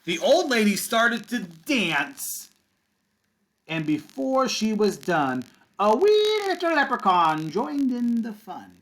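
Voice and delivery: drawn-out read-aloud building to the leprechaun; bedtime-story reading to kids; slow storytime build. Acted. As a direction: stretch it out, then lift on the wee little leprechaun.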